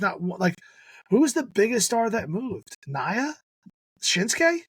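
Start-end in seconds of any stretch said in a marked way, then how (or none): 2.9-4.6 incredulous question. Real, not acted. Incredulous guess.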